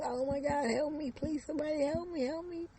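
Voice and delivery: high panicked cries for help, climbing; screaming for help. Acted. Woman in a nightmare screams for help, high panicked cries. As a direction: shriek for help at the top of her lungs. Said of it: "started screaming to the top of her lungs".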